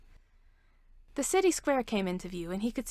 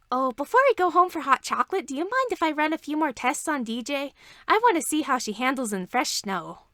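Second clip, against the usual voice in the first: louder, higher, bubbly request; eager princess; 5 semitones higher, 5 dB louder than usual. Acted. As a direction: bounce with excitement about the tests. Princess eager to test craft.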